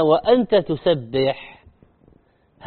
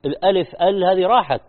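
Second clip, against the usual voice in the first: usual speaking voice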